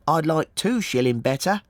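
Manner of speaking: put-on high-pitched child's bargaining; little boy. A narrator's reading. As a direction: answer as a small lad, pitched well up.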